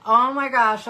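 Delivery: excited. Retold or imitated. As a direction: relive the excited 'oh my gosh' moment. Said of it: "even more excited because I was like".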